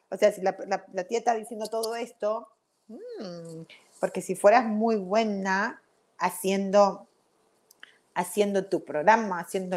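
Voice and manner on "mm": ordinary delivery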